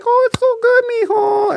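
squeaky-high mock mom voice; gushing mom. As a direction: coo extravagant praise in a very high pitch. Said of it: "it's all gonna be like".

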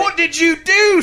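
high, loud, drawn-out accusing shriek; mock scream. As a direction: scream it like an outraged customer. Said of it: "screamed the lovely customer".

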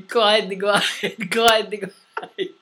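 slow mock robotic glitch voice. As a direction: imitate a voice breaking up, slowed down. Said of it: "a voice going".

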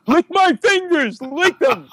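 very high, drawn-out mock scream; unhinged shriek, stretched out; hammed-up hysterical high yell; crazy man screaming. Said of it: "shaking like a crazy man".

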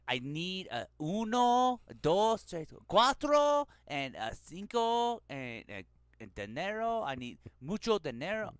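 high, loud mock-accented Spanglish; exaggerated accent, pleading for payment; put-on Spanish accent. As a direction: stretch the numbers out in a hammed-up accent. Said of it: "He's putting on the accent".

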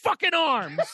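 hammed-up angry dare; mock angry challenge. Retold angry challenge while pointing.

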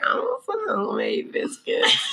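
very high, pleading whine; high whiny craving voice. Retold or imitated. Craving voice, quoting herself.

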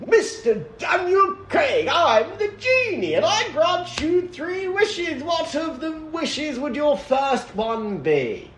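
high, slow, theatrical genie voice; grandly granting a wish like a cartoon professor; cartoon English professor. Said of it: "Why does he sound like a fucking English professor from a kid's cartoon?".

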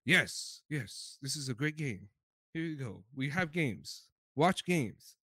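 low, soft, offhand company voice; mock calm Nintendo. Podcaster voicing Nintendo casually presenting games, low and soft. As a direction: hand it over like it's no big deal.

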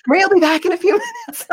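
high, fast, giggling mock announcer; laughing mock announcement. Host imagining covering for sick sister, laughing mock announcement. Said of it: "like that girl that has to do the tap dance".

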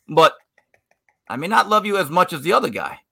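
ordinary delivery

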